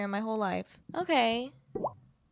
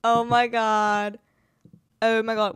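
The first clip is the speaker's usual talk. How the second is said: loud dismay; 6 dB louder than usual. Real, not acted. Items suddenly vanished.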